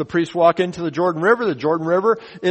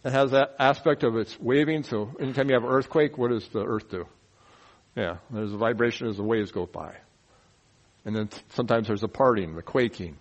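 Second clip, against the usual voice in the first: normal talking voice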